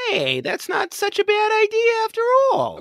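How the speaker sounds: high silly character voice. Acted. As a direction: squeak it out in a comic voice. Voicing a character's reaction.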